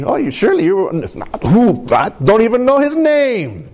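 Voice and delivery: high, protesting mock outburst; acted protest of innocence; indignant denial. Peter acted denying Jesus.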